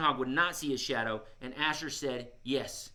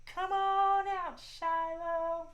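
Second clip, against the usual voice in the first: much higher, slow, sugary call; sweetest voice; 13 semitones higher, much slower than usual. Shown as a demonstration. Host models calling the shy squirrel, much higher sweet voice. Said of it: "In your sweetest voice".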